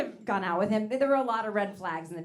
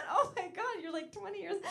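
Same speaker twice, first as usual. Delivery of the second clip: high flattered squeal; mock shock; 11 semitones higher than usual. Comedian flattered by a young guy, squealing. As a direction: play it giddy and shocked, much higher.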